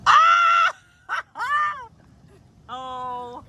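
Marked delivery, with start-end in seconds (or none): 0.1-0.7 sudden high scream. Real, not acted. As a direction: shriek out in shock. Jumping into the cold water.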